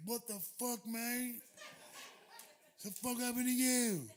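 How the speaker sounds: lightly voiced English driver's disbelief; driver's shock. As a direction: gape at the mess. Driver [name] reacts.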